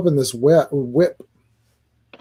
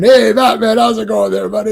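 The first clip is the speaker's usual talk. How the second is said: higher, louder mock greeting; hammed-up friendly cop, pitched up; mock chummy chief; 6 semitones higher, 4 dB louder than usual. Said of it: "who touches Batman?".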